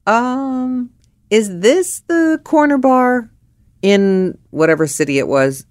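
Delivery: sweet, high, put-on politeness; overly polite. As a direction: ask the bartender with exaggerated courtesy. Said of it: "as only I could say".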